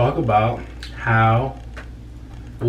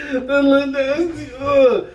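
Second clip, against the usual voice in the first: "That look nasty" laughed out high and slow; laughing disgust; 12 semitones higher, much slower than usual. Real, not acted. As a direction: squeal "nasty" through laughter.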